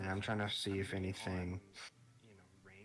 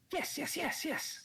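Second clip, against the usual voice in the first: very high, cheery little critter voice; high cheery chipmunk. Acted. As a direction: squeak it out like a happy chipmunk. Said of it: "A very high, cheery voice".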